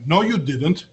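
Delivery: ordinary talk, nothing marked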